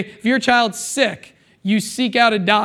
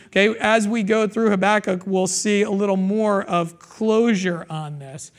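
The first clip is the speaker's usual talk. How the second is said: ordinary talk, nothing marked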